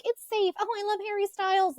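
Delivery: put-on high, girlish swooning voice; imitated dreamy teen fan; teenage girl crush. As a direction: gush like a smitten teenager. Voicing her seventeen-year-old crushing self.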